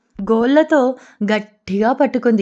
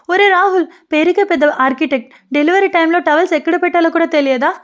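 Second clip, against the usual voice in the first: higher-pitched, exasperated scolding; mild narrated yell; 6 semitones higher, faster than usual. A narrator's reading. Wife in labour scolding her husband over the towels, mild yell. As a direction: scold him in a raised pitch, without a full shout. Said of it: "అంటూ ఈషా అరిచింది".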